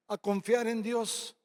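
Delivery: ordinary talk, nothing marked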